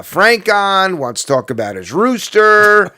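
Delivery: hammed-up local radio host; radio announcer. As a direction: announce it big, drawing out the key words. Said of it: "the announcer would be like".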